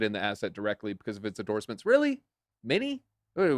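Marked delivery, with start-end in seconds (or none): 1.8-3.6 high-pitched disbelieving questions; incredulous. Real, not acted. Scoffs at the article's claim.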